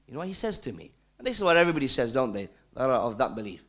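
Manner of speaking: normal talking voice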